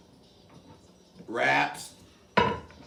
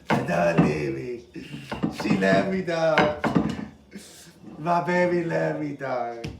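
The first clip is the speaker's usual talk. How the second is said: mock crying; 5 dB louder, slower than usual. Mock crying over onion.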